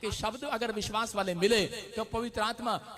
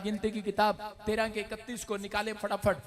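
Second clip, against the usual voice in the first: usual speaking voice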